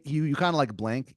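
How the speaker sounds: ordinary talk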